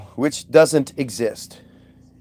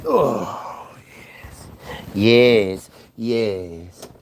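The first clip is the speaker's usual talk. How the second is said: ordinary talk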